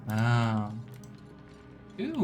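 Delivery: ordinary talk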